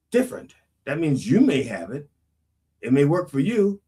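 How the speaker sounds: usual speaking voice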